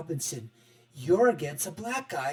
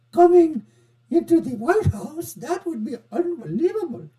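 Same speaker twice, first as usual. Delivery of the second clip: high, loud, badly faked Indian accent; poor Indian accent; 10 semitones higher, 5 dB louder than usual. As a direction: put on a hammed-up Indian accent, pitched way up. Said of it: "That's a poor Indian accent".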